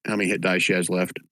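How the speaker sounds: ordinary delivery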